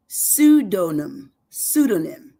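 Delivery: very slow "pseudonym", syllable by syllable; broken down. Shown as a demonstration. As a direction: stretch out "pseudonym" as SOO-doh-nim. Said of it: "I break it down".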